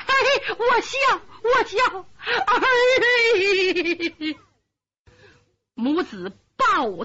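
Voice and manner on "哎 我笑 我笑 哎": laughing through tears. Acted. Mother laughing then crying.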